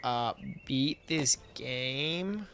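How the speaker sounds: put-on slow, drawn-out Valley girl accent; clumsy, exaggerated Valley girl drawl. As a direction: stretch it like a mock Valley girl. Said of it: "more like the word".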